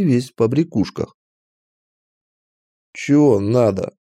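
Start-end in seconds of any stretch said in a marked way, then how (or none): 2.9-3.9 put-on slow, grudging old orc gatekeeper; displeased. A narrator's reading. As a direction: ask it slowly, annoyed at being bothered. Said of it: "недовольно спросил он". (недовольно, медленно)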